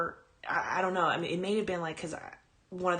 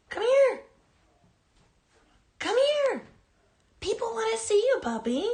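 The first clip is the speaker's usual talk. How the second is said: much higher, louder sing-song dog voice; pet talk; 7 dB louder than usual. Real, not acted. Host coaxes her dog to greet viewers, high loud baby talk. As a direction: coo at the puppy to coax her over.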